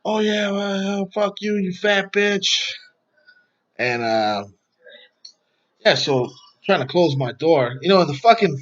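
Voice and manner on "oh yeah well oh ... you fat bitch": higher, drawn-out imitation of her ranting; mock unhinged rant. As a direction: mimic her losing it, stretching the insult. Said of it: "she starts losing her fucking mind".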